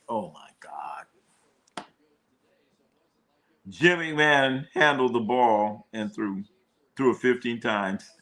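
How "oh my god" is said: breathless slow whisper of despair; whispered dismay. Real, not acted. Fan reading the Bears quarterback list, whispered dismay. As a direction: whisper it in disbelief.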